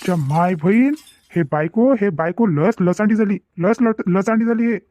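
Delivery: ordinary talk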